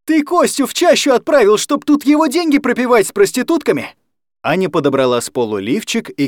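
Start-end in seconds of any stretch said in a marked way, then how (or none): 0.0-4.0 high, loud accusing yell; furious shout. Acted. Anya accusing her guardian of drinking away her brother's money, furious shout. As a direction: hurl the accusation at him.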